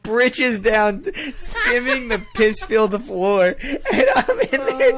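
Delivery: loud, high, laughing through the words; rising into helpless laughter; real laughter. Real, not acted. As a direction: push the story out while cracking up. Cracking up at own story.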